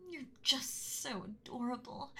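usual speaking voice

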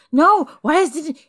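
high, alarmed outburst; panicked protest. Acted. Realizes the treat contains catnip.

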